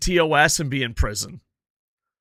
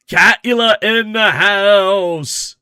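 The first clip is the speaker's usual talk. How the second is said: loud hype announcement; 5 semitones higher, 8 dB louder, much slower than usual. Real, not acted.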